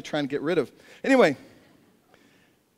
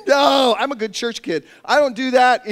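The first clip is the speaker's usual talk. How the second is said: mock indignant; 4 semitones higher, 6 dB louder than usual. Refusing as church kid.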